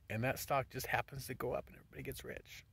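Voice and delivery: ordinary talk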